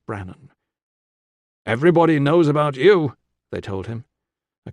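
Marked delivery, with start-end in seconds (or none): 1.6-3.2 louder, higher, effusive greeting; admiring greeting. A narrator's reading. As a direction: gush to a little celebrity. Hospital staff greet famous boy.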